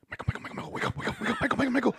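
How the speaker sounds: urgent repeated calls rising high and loud; mimicking a panicked cousin; cousin's frantic wake-up call. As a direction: shake someone awake with your voice. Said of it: "my cousin going like".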